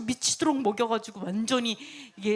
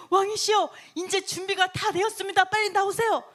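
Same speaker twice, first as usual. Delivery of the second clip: acted-out urgent announcement, pitched up high; calling out; 7 semitones higher than usual. Servant calls the king at sunrise.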